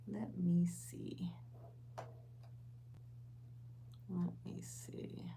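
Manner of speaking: slow, whispered self-talk; hushed, drawn-out muttering; muttering to herself. Real, not acted. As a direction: think aloud under your breath, unhurried. Muttering to herself while checking screen.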